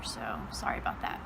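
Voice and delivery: sheepish whispered apology; whispered aside. Real, not acted. Joking aside after ditching friend.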